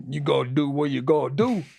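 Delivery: put-on dad's low Bajan accent; low-pitched Bajan father's retort. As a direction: answer as a Bajan dad. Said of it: "said in his Bajan accent".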